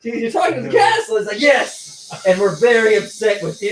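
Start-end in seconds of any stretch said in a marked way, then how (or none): 0.2-1.2 loud, high mock disbelief; incredulous question. Retelling Dee questioning the sick man, loud incredulous question. 1.4-3.7 indignant. Retold or imitated. Crazed character's indignant answer.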